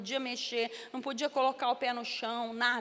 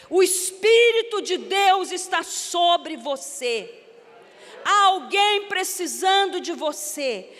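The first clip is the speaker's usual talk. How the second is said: louder, slow, fervent sermon call; preaching at full force; 3 semitones higher, 9 dB louder, slower than usual. Acted. Preacher calling the standing congregation, loud and slow. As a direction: proclaim it over the crowd, slowly.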